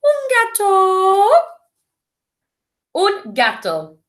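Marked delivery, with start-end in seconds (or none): 0.0-1.3 very high and slow, drawn out; silly cat voice, slowly. Shown as a demonstration. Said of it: "You say it".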